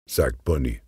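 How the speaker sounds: ordinary delivery, nothing marked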